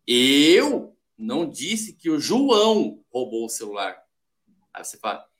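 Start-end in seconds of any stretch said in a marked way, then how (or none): 0.1-4.0 slow, with "João" drawn out and emphasized; stress on JOÃO. Shown as a demonstration. Coach showing different interpretations, heavy stress on "João". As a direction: lean hard on "João", slowly. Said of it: "falado com tons diferentes".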